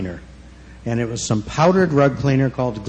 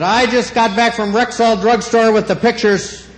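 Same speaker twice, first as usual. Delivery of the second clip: stern mother voice; 9 semitones higher than usual. Retold or imitated. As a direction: lay out the proof like a stern mom. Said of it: "My mother said".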